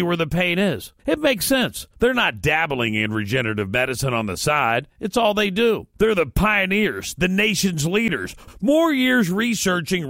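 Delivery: friendly pitchman selling the clinic; ad read. Acted. Host reading the clinic ad to listeners, soft pitchman voice.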